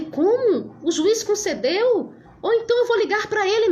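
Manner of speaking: high, astonished disbelief; surprised. Retold or imitated. Lawyer on the phone, stunned by the judge's decision, surprised. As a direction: voice jumps up in shock at the news.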